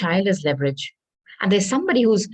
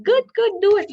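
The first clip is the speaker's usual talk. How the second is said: egging on; 11 semitones higher than usual. Retold or imitated. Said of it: "And they're saying".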